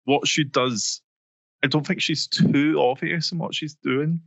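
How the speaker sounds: usual speaking voice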